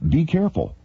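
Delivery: ordinary talk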